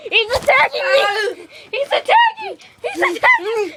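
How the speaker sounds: high, laughing panicked yelp; mock panic. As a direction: squeal as if under attack. Mock panic, monkey attacks.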